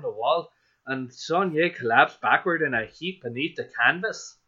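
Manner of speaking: put-on lilting Irish accent. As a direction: read the passage with an Irish brogue. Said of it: "was Irish".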